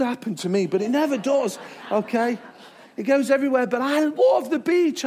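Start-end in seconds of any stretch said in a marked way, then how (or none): 3.7-5.0 raised, drawn-out LOVE; lingering, high delight; gushing enthusiasm. Real, not acted. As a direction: burst out with playful adoration. Gushing about the beach again.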